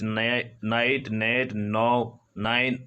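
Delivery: Night stretched very slowly; slow, drawn-out night; slow model word. Shown as a demonstration. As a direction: draw out night so students hear each sound. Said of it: "इसके लिए आता है night".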